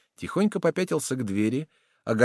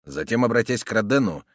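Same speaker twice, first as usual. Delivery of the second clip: ordinary delivery, nothing marked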